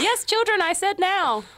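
play-acted motherly command; mock stern mother. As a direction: call out like an exasperated mother. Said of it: "I'll be the mother".

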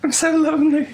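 high, lonely falsetto plea; mock-forlorn, high and eerie; creepy voice. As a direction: play a creepy little voice begging for company. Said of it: "creepy voice for, like, three minutes".

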